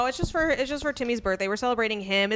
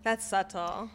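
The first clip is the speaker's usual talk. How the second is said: drawn-out, softer "subtle"; pointed remark; 5 dB quieter, slower than usual. Real, not acted. Repeating a verdict on the sister's hints, pointed. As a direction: repeat it pointedly, held back in volume. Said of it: "Why you say it like that?".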